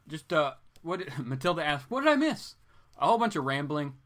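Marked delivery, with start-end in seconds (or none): 1.9-2.6 high mock voice. Quoting a chat question.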